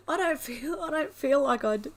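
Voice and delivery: high, tearful protest after a laugh; overcome, stumbling through tears; crying. Real, not acted. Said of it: "when you cry, your voice goes a bit funny".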